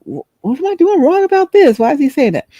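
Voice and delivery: high, baffled self-questioning; confused and defensive. Retold or imitated. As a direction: caught off guard at the gas pump, high and puzzled. Inner confused reaction.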